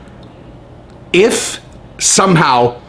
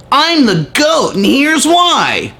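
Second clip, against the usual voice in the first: high, slow mocking brag; fake Conor boast; 8 semitones higher, much slower than usual. Vlogger mocking the fake Conor account, high slow brag. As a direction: drag out the boast like a poser. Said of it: "You sound like you're trying to sound like Conor".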